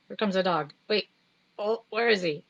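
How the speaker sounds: ordinary delivery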